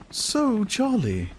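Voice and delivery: high, slow, inviting coax; a woman tries to seduce him out of gloom; cajoling. A narrator's reading. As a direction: draw it out invitingly, smiling. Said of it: "smiled ... voluptuous cajolery".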